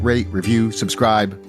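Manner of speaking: ordinary talk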